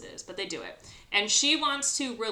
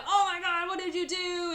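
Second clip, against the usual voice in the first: high, loud horrified gasp of words; shocked; 12 semitones higher, 7 dB louder than usual. Retold or imitated. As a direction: recoil in shock at the sight. Giles finds cat eaten.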